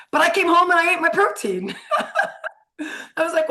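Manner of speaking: real laugh breaking into the words. Real, not acted. Laughing at her own story.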